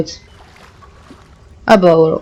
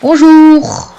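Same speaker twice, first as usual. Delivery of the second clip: mock greeting; 6 semitones higher than usual. Real, not acted.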